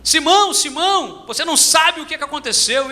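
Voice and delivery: high, urgent call with the news; excited brother. Retold or imitated. Brother running back to Simão with news, excited. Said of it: "voltou de lá correndo e disse assim".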